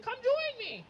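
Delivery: high, bright mock grandma call. Grandma in the hot tub calling to her grandson, high mock invite.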